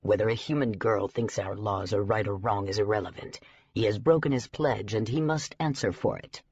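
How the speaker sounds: stern elder insisting on the law; hard tone. A narrator's reading. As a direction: pass judgment without room for argument. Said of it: "Bancroft's tone was hard".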